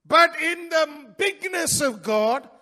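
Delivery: loud, high, ringing proclamation; full-force preaching. Real, not acted.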